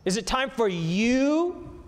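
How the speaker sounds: slow build to a high, rising "you"; stress on you. Shown as a demonstration. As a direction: stretch "you" upward as a challenge. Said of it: "That's the emphasis".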